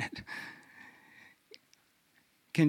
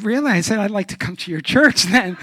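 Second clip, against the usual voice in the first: loud mock disbelief; higher, louder ironic surprise; sarcastic; 5 semitones higher, 10 dB louder than usual. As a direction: feign shock to mock him. Sarcastic retort in story.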